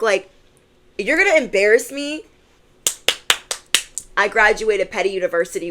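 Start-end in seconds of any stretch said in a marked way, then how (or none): none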